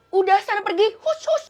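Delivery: high, loud dismissive shoo; scornful shooing. Acted. Classmates drive away the outcast, scornful shooing. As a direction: shoo them off like a stray animal.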